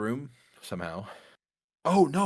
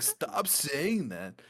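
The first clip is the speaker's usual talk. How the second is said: higher, dragged-out mock pleading; whiny, stretched-out protest; exasperated plea; 6 semitones higher, much slower than usual. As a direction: beg him slowly to quit it. Retold plea to bar guy.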